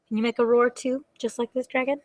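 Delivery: usual speaking voice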